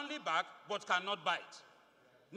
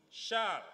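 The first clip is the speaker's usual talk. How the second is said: shall punched louder and clipped short; stress on shall; 2 semitones higher, 4 dB louder than usual. Shown as a demonstration. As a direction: lean hard on shall, then pause. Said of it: "again, emphasis on shall".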